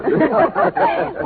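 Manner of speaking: delighted high giggle; squealing laugh. Acted. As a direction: laugh off the teasing. Bride laughs at the teasing.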